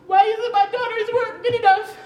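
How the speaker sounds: loud, high imitation of an indignant dad; dad's exasperated question, loud and high; mock indignant dad. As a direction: throw the question out loudly like her father.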